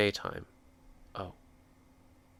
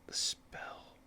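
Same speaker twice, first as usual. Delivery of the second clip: breathy whispered discovery; hushed realization, almost silent; 18 dB quieter than usual. Real, not acted. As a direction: whisper it to yourself as you notice it. Noticing a dropped item.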